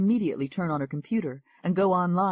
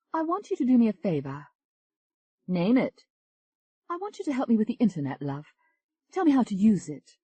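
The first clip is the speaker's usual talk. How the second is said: higher, eager English woman; higher English lady asking a favour; [name]'s voice; 7 semitones higher than usual. Acted. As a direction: coax her friend, lightly pleading. [name] pleads with Kathy.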